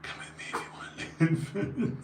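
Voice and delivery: gravelly, voiceless movie-hero rasp; Kyle Reese impression. Streamer retrying a film line he can't do, voiceless gravelly rasp. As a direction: rasp the line out with hardly any voice. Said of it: "say it with a gravelly voice".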